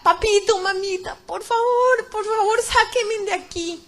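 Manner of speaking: high, desperate call for help; pleading shout. Retold or imitated. Daughter in the pit calls to her parents, pleading shout. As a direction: cry out to them, slowing on the final plea. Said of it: "Yo empecé a gritar".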